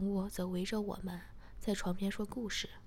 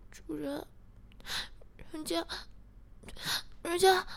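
pouting; 9 semitones higher than usual. A narrator's reading. Character answering the narrator, high halting pout. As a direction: pout it in stops and starts, pitched up. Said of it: "小苗嘟着嘴说".

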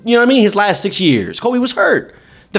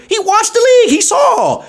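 excited; 14 semitones higher than usual. Real, not acted. Excited point about LeBron.

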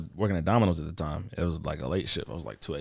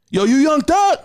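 much higher, loud eager call; excited shout; 10 dB louder, slower than usual. Retold or imitated. Fan going from chill to shouting at [name], high and loud. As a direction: run up on him shouting. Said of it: "chill to, like".

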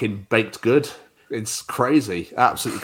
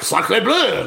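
put-on French accent; 9 semitones higher than usual. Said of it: "It was gonna fucking lunge at them like".

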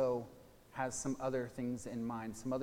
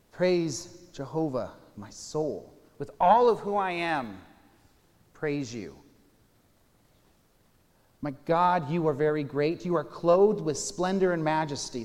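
proclaiming scripture; 3 semitones higher, 9 dB louder than usual. Acted. Pastor reads Psalm 104 aloud to his listeners, proclaiming it loudly. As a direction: declaim it like a psalm of worship.

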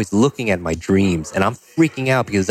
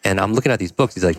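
ordinary talk, nothing marked

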